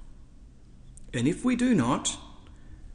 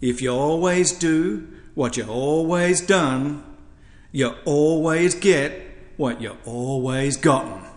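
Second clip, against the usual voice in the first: Archdeacon Elaine. Retold or imitated. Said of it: "see if I can do her accent".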